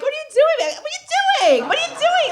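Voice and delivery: very high, sing-song bewildered question; mock ditzy smile. Woman grinning at a jealous man, very high and bewildered. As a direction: play dumb and sweet. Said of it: "you're like".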